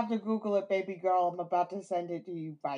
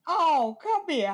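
hammed-up southern cooing; mock southern mama; 8 semitones higher, 4 dB louder than usual. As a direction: coo it like an overdone southern hostess. Joining the Paula Deen bit.

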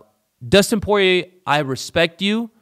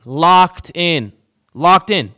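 louder, repeated punch on locked in; emphatic shout; 3 semitones higher, 9 dB louder, much slower than usual. Real, not acted. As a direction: bang the table on "Locked in". Hammering home the locked guillotine.

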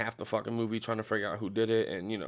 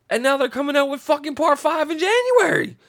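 much higher and louder, building to the end; outraged exclamation; 7 dB louder than usual. Real, not acted. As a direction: throw your hands up in disbelief. Outraged about part five.

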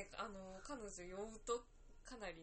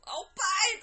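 put-on drunk yelling; 10 semitones higher, 16 dB louder, much faster than usual. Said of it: "うわー揉みながら".

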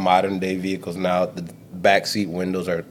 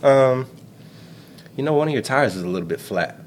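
casual fake friendly; 5 semitones higher than usual.

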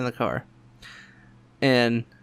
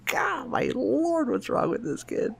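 exasperated; 12 semitones higher, slower than usual. Retold or imitated. Exasperated at son.

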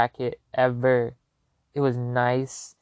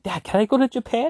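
excited plea; 10 semitones higher, 4 dB louder than usual. Retold or imitated. Asking dad excitedly.

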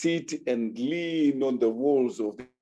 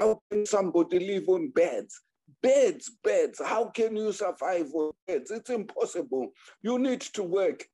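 higher, scornful retort about the beds; angry husband; 6 semitones higher than usual. Retold or imitated. Angry husband mocking wife's chickens, higher and scornful. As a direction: scoff at the idea of living on beds. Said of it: "he was angry".

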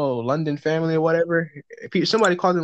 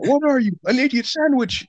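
high-pitched mock chef scolding routine; Gordon Ramsay; 7 semitones higher than usual. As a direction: act out the kitchen telling-off, hammed up. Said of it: "get Gordon Ramsay up here".

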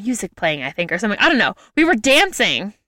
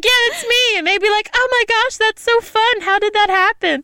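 much higher bubbly mock voice; giddy tourist chat; 12 semitones higher than usual. Strangers recognizing her in Venice, high giddy mock voice. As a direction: play it up as a giddy celebrity. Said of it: "And I'd be like".

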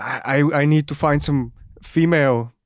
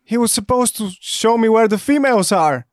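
angry; 8 semitones higher than usual. Acted. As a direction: chew them out for shooting the friend. Hamster furious friend shot.

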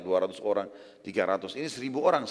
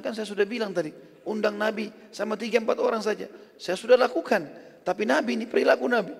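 exasperated wife; 9 semitones higher than usual. Acted. Wife answers her flustered husband.

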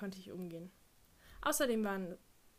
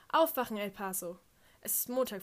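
loud, bright announcer call; radio DJ wake-up; 7 dB louder than usual. Retold or imitated. DJ greeting El Paso listeners, loud announcer call. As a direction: wake the city up over the air.

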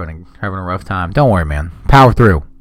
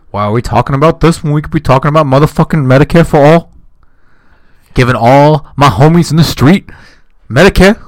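loud high rant; 5 semitones higher, 5 dB louder than usual. Retold or imitated. Bernie-style rant, loud high.

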